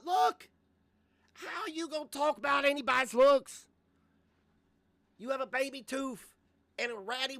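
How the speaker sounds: high, loud exasperated rant; exasperated outburst. Real, not acted. Calling out a YouTuber over his looks comment, exasperated yelling. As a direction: throw up your hands and yell it.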